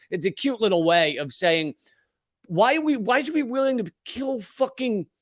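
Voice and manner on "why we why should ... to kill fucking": raised higher, pleading outrage; pitch raised. Shown as a demonstration. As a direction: ask it the way it should sound. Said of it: "this is how it should be said at that timbre".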